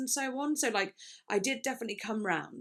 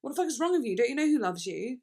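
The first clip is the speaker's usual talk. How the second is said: higher, livelier acted challenge; pleading love interest; 3 semitones higher, 3 dB louder than usual. Love interest to the singer who feels unloved, exasperated plea. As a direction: act out a partner's exasperated plea. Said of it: "love interest being like".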